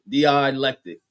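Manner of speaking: "Dialectic" with a stretched die-eye opening; "Dialectic" with the eye sound drawn out; deliberate mispronunciation. Shown as a demonstration. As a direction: say "Dialectic" as dee-EYE-lectic, stubbornly. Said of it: "that's how I'm gonna say it".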